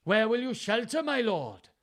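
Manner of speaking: mild calling out; called out. Acted. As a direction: call after the king across the rain. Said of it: "Gloucester called out".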